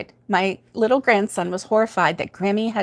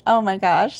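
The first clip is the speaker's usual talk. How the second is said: usual speaking voice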